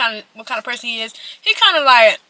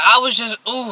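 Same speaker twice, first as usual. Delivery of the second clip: normal talking voice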